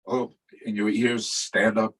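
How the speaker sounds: usual speaking voice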